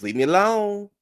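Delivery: hammed-up high plea; mock whine. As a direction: whine it high like a wounded diva.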